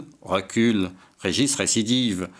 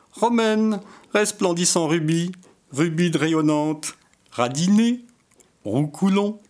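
flirty suitor; 7 semitones higher than usual. Acted. Raoul risks flirting with Romaine.

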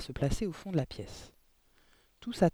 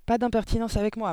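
louder, higher rebuke; angry cry; 6 semitones higher, 6 dB louder than usual. A narrator's reading. Headmistress rebuking the teacher, angry cry. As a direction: cut her off with a raised voice. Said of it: "cria mademoiselle Le Gourdin".

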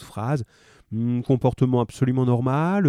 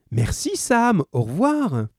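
high, beaming thank-you; radiant smile; 8 semitones higher, slower than usual. A narrator's reading. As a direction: thank him with a big smile. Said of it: "avec un sourire radieux".

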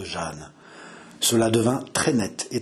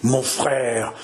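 madman's voice. Acted. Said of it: "murmura François d'une voix de fou".

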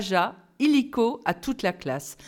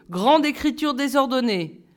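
plain reading voice

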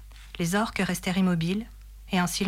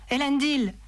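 higher, restrained war cry; narrated battle cry; 7 semitones higher than usual. A narrator's reading. Aragorn rallying as he runs, mild battle cry. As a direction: lift the name like a rallying call. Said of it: "cria-t-il".

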